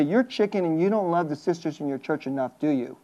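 hushed, low reproach; God's quiet rebuke. Acted. God to the fearful preacher about his church, hushed low reproach. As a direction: voice a soft divine challenge. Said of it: "God will say".

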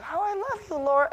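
put-on high-pitched cooing to a little girl; doting father. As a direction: coo the affection in a lifted, singsong voice. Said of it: "I wish I could do that".

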